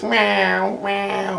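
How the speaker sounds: high, soft, drawn-out meow; cat meow. Retold or imitated. Storyteller mimics a cat at the balcony, high soft meow. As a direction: imitate a pleading stray cat. Imitates stray cat meowing.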